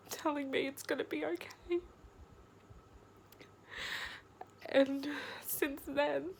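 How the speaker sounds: real crying, barely holding it together; tearful, upset. Real, not acted. Remembering her mum's hug, choked up and tearful. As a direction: voice rising high with held-back tears. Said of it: "I'm getting upset again".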